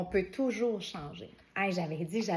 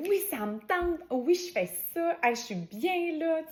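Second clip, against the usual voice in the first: cheerful yes-saying voice; 8 semitones higher than usual. Retold or imitated. Voicing a confident yes.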